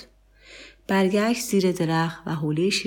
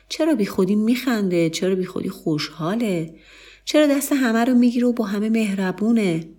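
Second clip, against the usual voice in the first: higher-pitched, scornful questioning; jeering friends; 5 semitones higher than usual. A narrator's reading. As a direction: voice the friends' mocking disbelief.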